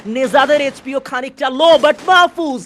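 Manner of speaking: High pitch, জোরে, উত্তেজিত live ধারাভাষ্য; চিৎকার করে কিল ঘোষণা; esports caster. Acted. Live play-by-play of a fight. (উত্তেজিত ই-স্পোর্টস কাস্টারের কণ্ঠ)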